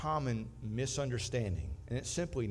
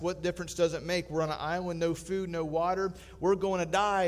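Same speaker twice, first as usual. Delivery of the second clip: loud, higher frantic plea; first castaway frantic at calm friend; 4 semitones higher, 4 dB louder than usual. Acted. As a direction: beg him to see it.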